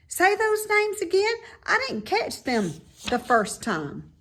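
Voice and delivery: high, loud piggy voice; a young pig, eager and impressed; bright squeaky pig voice; Wilbur the pig. A narrator's reading.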